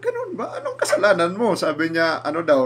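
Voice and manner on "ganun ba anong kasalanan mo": much higher, laughing through the words; amused, asking between chuckles; laughing question. Retold or imitated. Fiscal amused by the story. (natatawang tanong ng piskal)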